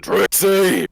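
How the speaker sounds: loud high yell of a name; shout. Acted. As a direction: call Trixie out at full voice. Player shouts a character name.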